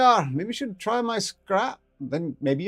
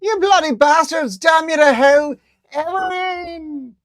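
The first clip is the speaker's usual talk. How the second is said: very high, loud curses, slow drawn-out name; anguished cursing; 14 dB louder, slower than usual. Acted. Ferret dragged off by guards, cursing and wailing. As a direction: curse them and wail her name.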